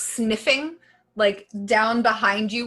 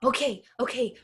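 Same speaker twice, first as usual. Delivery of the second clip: put-on excited child; 5 semitones higher than usual. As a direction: bounce with a child's glee at the game. Kid thrilled by hide and seek.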